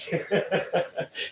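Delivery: airy, voiceless laugh shaking through; breathless silent laugh. Real, not acted. Man laughing at his own crazy story, breathless silent laugh. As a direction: laugh without voice, wheezing.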